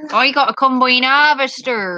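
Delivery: broad Norfolk farmer; proper Norfolk accent. Shown as a demonstration. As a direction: stretch the vowels like a Norfolk farmer. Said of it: "That's how the farmers around here talk".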